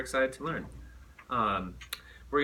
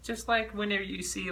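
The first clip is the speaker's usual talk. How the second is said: normal talking voice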